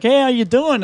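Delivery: high, chummy retold greeting; friendly greeting. Old acquaintance messages the man who burned him, mock friendly.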